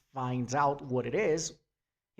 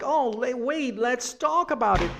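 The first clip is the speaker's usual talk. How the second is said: pleading mother; 10 semitones higher, 5 dB louder than usual. Retold or imitated. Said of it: "when she's like".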